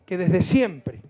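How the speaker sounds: ordinary talk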